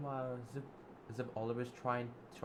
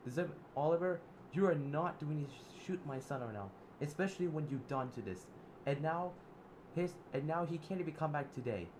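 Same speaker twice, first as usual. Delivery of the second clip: mother Alice scolding Zip and Oliver; fed-up anger; 5 semitones higher than usual. Acted. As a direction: lay down the law, done with them. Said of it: "Alice said".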